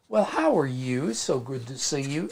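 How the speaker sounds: imitated Southern accent greeting; mock hospitable Indianapolis Southern accent. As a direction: greet like a polite Southern local. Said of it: "But with the Southern accent".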